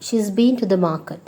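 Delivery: "She's" as one syllable; contracted form. Shown as a demonstration. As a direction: fold "has" into "She's". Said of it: "you can just say".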